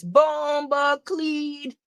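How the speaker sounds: slow, drawn-out mock Jamaican accent; hammed-up Jamaican exclamation, slowly. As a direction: stretch the words in a playful Jamaican accent. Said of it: "It done gave [name] an accent".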